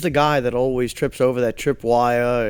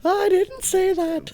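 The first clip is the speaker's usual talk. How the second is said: mock high voice; slower than usual. Mock high voice denying remark.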